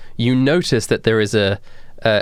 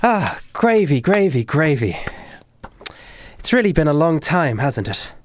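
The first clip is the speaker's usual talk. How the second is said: put-on woman greeting old acquaintance; 5 semitones higher than usual. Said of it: "looks at Gravy and goes".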